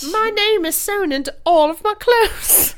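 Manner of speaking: put-on high, bright girl voice from a sketch; man doing a woman's voice. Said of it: "that girl from that sketch".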